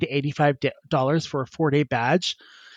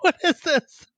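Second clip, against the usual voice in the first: high disgusted question. Retold or imitated. Disgust at suit inside.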